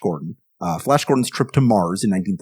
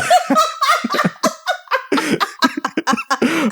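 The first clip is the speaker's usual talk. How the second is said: high laugh. Real, not acted. Laughing at own old voice.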